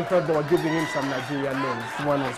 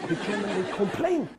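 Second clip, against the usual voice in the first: ordinary talk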